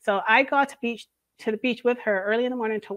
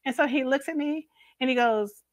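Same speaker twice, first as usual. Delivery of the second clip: usual speaking voice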